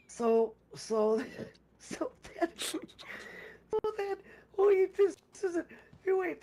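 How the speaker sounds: high, breathy laughter breaking through the words; can't stop giggling at how dumb it got; laughing while telling. Real, not acted. Telling a friend how stupid talk caused a murder, laughing.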